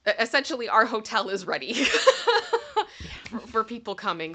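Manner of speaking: words slipping into real laughter; laughing. Real, not acted. Laughs mid-sentence about hotel.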